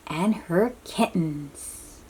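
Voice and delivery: ordinary delivery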